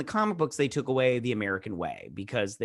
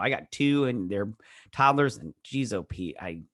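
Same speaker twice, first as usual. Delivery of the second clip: normal talking voice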